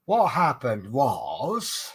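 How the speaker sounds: very slow, drawn-out comic storyteller's opener; Jethro impression. Comedian opening a long yarn, in a drawled impression. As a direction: stretch it out with a swooping last word. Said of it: "if I tell you a story like Jethro".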